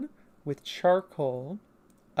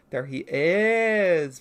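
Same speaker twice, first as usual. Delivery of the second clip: very slow and louder, IS stretched long; drawn-out reveal; 3 semitones higher, 6 dB louder, much slower than usual. Real, not acted. Presenting Murgo to viewers, drawn-out reveal. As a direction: stretch out the word is in a big reveal. Same words repeated for effect.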